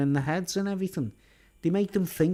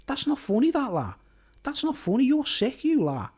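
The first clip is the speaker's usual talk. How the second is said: mimicking Scouse lad; 8 semitones higher than usual. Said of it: "he was going".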